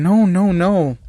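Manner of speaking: startled, bashful string of noes; flustered, blushing. A narrator's reading. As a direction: protest in a fluster. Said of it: "Ochako blushing, saying".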